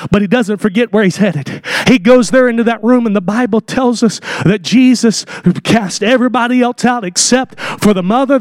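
normal talking voice

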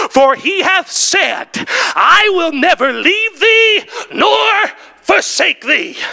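slow, high, shouted verse; preacher booming scripture; proclaimed scripture. Acted. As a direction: declare the promise slowly. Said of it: "tells us this".

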